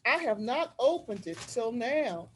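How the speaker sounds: usual speaking voice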